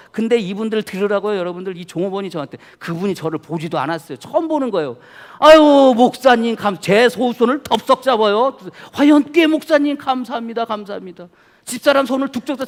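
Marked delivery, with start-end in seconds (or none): 5.4-11.3 effusive thanks. Retold or imitated. Waiter effusively thanking pastor.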